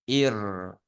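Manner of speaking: إر as one syllable, "iir" with a held rolled r; blended syllable. Shown as a demonstration. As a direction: run the long "i" straight into a trilled r in إر. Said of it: "إي را".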